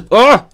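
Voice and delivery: loud sneeze then yelp. Real, not acted. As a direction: sneeze and cry out. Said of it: "I've got another one coming".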